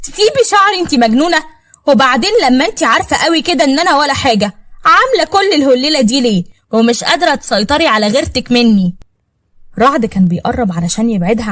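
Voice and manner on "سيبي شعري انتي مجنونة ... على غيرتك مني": yelling. Acted. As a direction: yell at the woman pulling your hair. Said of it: "وهي بتزعق".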